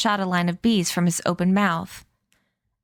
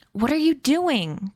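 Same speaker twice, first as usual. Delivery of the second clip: higher, slow alarmed cry; 6 semitones higher than usual. A narrator's reading. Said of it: "cried Millard".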